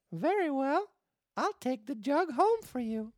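put-on high puppet-boy voice giving in; firm Pinocchio. Pinocchio accepting the water lady's bribe, high firm voice. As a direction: agree firmly as a little puppet. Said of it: "and said firmly".

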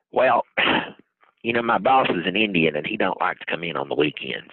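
raspy smoker's voice; gravelly front desk clerk, rasped out. Retold or imitated. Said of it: "How did you get that smoker's voice so quickly".

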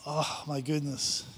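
ordinary talk, nothing marked

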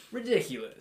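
lightly mocked British disdain; mock British scoff. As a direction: sniff it like a scandalised Brit. Mocking British tolerance for spice.

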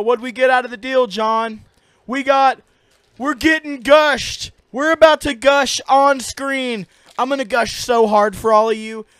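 hammed-up hype. Host announces they will eat Gushers, hammed-up excitement. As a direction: punch each word to pump up the crowd. Hyping up candy on screen.

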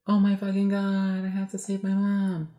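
high, slow mock-dramatic realization; Sophie. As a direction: play a girl's drawn-out, hammed-up alarm. Voices Sophie's panicked realization.

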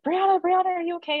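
high, loud frantic call of her name. Retold or imitated. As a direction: call out for her in a panic from the next room. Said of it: "came around the corner yelling for me".

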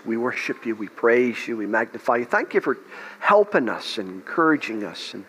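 soft, low worship prayer murmured to the Lord; fervent prayer. Acted. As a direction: pray quietly over the congregation. Leads congregation in prayer.

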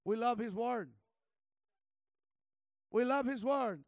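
loud, driving preacher's cry; rally the church with each word; fiery preaching. Real, not acted. Preacher rallies the congregation, loud fiery preaching.

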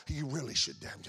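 low, hushed disapproving aside; mock a churchgoer's condescending reproach under the breath; looking down her nose. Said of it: "looks down her nose at".

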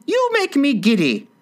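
high screechy protest from a queen; irritated, very high queen voice; screamed complaint. A narrator's reading. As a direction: shriek it, pitch way up. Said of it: "screamed the Queen".

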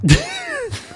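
squealing burst of laughter; high-pitched laugh. Real, not acted. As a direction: shriek with laughter at him. Reacts to rambling co-host.

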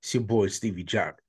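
booming ring announcer intro; grand entrance announcement. Acted. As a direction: announce it like a wrestling emcee. Podcast opening hype intro.

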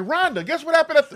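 high, loud mock homecoming announcement; excited calling out. Imitating man telling his wife at home, excited. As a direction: call to your wife with hammed-up news.

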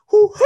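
very high, loud "hoo hoo"; high mock hoot. Comic retelling his reaction to the owner, high mock hoot. Mimics own nervous reaction, then laughs.

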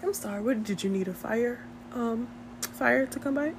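soft, hushed mock operator; smooth dispatcher voice. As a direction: play a smooth emergency dispatcher, softly. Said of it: "hit you with a smooth voice".

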